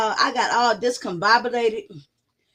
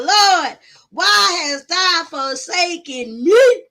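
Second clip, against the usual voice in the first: louder, higher, slow cry to the Lord; retold wail; 6 semitones higher, 5 dB louder, much slower than usual. Retelling her crisis to chat as a mock biblical wail. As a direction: cry out to heaven, hammed-up despair. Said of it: "I was up here saying".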